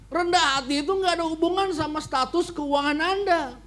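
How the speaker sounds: ordinary talk, nothing marked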